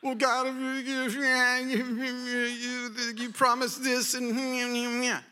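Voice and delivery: high, slow complaining whine; high drawn-out whiny complaint; whiny Gideon. Retold or imitated. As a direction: whine like a reluctant hero. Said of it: "Gideon starts complaining".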